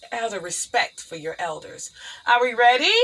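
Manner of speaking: ordinary talk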